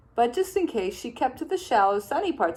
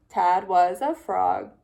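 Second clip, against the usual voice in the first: ordinary delivery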